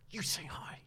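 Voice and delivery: hissed whisper, pushing him to go; urgent whisper. Retold or imitated. Girlfriend pushes boyfriend to greet Taika, whispering. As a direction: whisper it so the celebrity can't hear.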